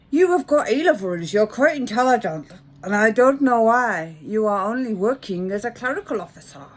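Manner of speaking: loud, put-on London accent. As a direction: lecture like a mock Londoner. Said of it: "In the London accent".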